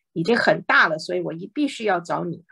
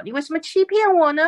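crying out at the top of her voice; 8 semitones higher, faster than usual. Acted. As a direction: call out the betrayal in a raised voice. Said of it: "高声的喊叫".

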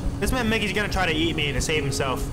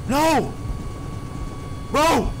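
panicked shout; 7 semitones higher than usual. Real, not acted. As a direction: yell out in fright. Chased by Mickey.

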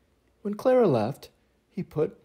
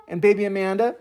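ordinary delivery, nothing marked

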